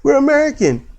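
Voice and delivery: high-pitched mocking repetition; mock insistence. Mimicking the girls' repeated boast.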